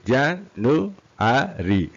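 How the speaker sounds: very slow JAN - NU - A - RI; four separate beats on January; syllable by syllable. Shown as a demonstration. Teacher demonstrates four syllables, syllable by syllable.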